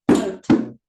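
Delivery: loud PHO-to, beaten out; stress on first syllable. Shown as a demonstration. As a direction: punch PHO in photo. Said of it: "see where the stress changes".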